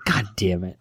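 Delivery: low grumbled under breath; muttered curse. Retold or imitated. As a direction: mutter it to yourself, annoyed. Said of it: "I just internal John".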